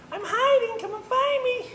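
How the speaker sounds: very high, squeaky singsong; teasing tiny mouse in hide-and-seek; little girl mouse. Acted. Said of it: "She goes".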